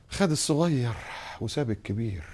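bitter, fading into a soft grumble; discontented complaint. Retold or imitated. The resentful believer complaining about his loss. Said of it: "ويعبر بقى".

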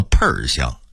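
喷儿香 with erhua and changed tone; Beijing pronunciation. Shown as a demonstration. Teacher demonstrating the Beijing tone change of 喷香. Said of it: "变调之后成了喷儿香".

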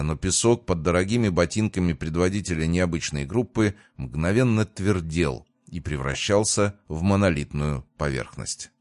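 usual speaking voice

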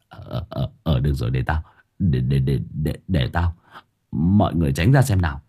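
low, stammering gruff voice; flustered gruff man. Acted. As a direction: stumble over the words, gruff. Flustered man takes charge. (giọng đàn ông trầm lắp bắp)

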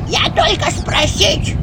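high, loud, slow bark; old woman barking. A narrator's reading. Old woman pushes ahead in the queue, barking. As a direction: snap it pushing forward. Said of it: "рявкнула бабка".

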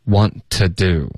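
ordinary delivery

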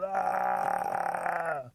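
long high loud "aah"; hollered wail. A narrator's reading. Rabbit keeps hollering from sticker bushes, mock wail. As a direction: sustain a put-on howl. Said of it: "He keeps hollering".

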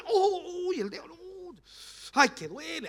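hammed-up ow over a sore finger; mock pain. Person knocking the cut finger, mock pain.

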